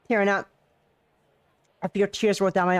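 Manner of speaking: emotional outburst, Tearing up. Real, not acted. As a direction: announce you're tearing up.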